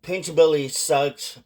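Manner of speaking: normal talking voice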